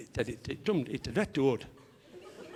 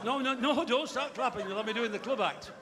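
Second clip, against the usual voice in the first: protesting; 8 semitones higher than usual. Real, not acted. Telling audience to stop clapping.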